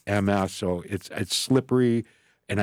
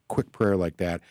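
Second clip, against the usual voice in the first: usual speaking voice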